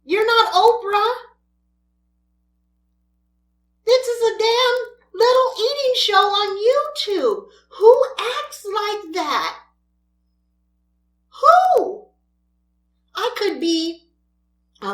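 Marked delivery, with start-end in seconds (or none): 0.0-9.6 raised, louder and higher voice; angry rant. Real, not acted. Ranting at a feuding YouTuber. 11.4-12.1 sharp high loud yell; outraged shout. Real, not acted. Ranting at a feuding YouTuber.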